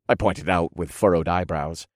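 plain reading voice

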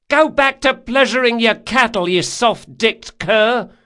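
very high, loud taunting yell; jeering shout. A narrator's reading. Girl at the bow answering galley man, jeering shout. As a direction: yell the insult back, drawing out the end.

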